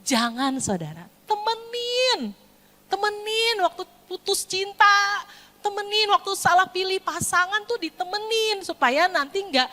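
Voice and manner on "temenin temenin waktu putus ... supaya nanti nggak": high, stretched preaching exhortation. Real, not acted. Preacher urging parents to stay alongside, pleading. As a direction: hold the key word long and high.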